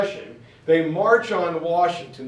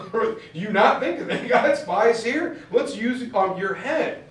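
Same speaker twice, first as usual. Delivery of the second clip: much higher, mock-incredulous general; incredulous Patton; 6 semitones higher than usual. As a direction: toss it back like an exasperated general who can't believe the objection. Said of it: "It's like".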